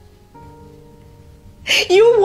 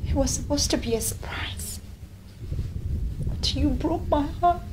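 hushed; 2 semitones lower, 12 dB quieter than usual. Acted. Confessing her pregnancy, hurt.

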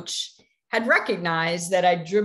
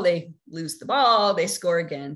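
ordinary delivery

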